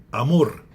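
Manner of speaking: Amor said loud with a strong final mor; stress on last syllable. Shown as a demonstration. Teacher giving an example, "Amor" stressed on mor. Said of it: "também é tonificado na última sílaba".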